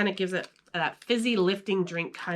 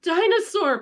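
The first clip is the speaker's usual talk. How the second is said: stammering, rising higher and louder, breaking off on each word; sputtering; 8 semitones higher, 6 dB louder than usual. A narrator's reading. PJ sputtering in shock at the baby dinosaur. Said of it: "P J sputtered".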